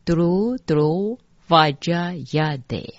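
Dru Dru Vajayade, slowly syllable by syllable; whole mantra line. Shown as a demonstration. Teacher giving all of line twenty-eight, slow pronunciation demo. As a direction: sound out Dru Dru Vajayade slowly, drawing out each Dru. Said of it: "二十八句整个".

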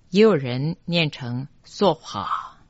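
ordinary talk, nothing marked

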